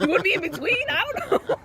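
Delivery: high, laughing words. Retold or imitated. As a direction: laugh your way through it. Laughing retelling the exchange.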